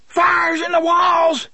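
high, loud scream of panic; a fleeing man screaming to anyone near; panicked scream. A narrator's reading. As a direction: shriek the alarm in terror. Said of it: "he screamed".

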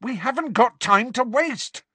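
high, impatient shout. A narrator's reading. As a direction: shout to hurry them. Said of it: "shouted Harry".

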